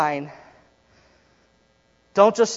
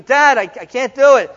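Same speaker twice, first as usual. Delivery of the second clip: put-on much higher, louder tearful kid; hammed-up crying child; mock crying boy; 9 semitones higher, 11 dB louder than usual. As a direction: wail it like a boy near tears. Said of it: "He's crying".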